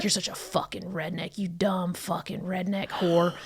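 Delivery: soft, slowing to a near-whisper; imitated quiet insult. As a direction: imitate the boyfriend's hushed insults, drawing out the end. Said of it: "saying it all so fucking quiet".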